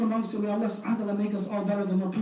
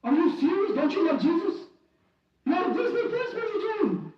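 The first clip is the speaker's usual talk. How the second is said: lightly mocked outrage, pitch climbing much higher; 6 semitones higher than usual. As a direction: scoff in disbelief, rising higher to the end. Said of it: "They're saying".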